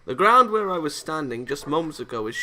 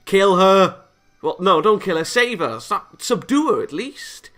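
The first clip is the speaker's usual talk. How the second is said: loud excited yelling at the screen; shouting; 8 semitones higher, 11 dB louder than usual. Real, not acted. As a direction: yell it, then backpedal frantically. Player yelling at game.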